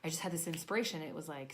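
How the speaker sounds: ordinary talk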